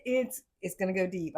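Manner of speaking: ordinary delivery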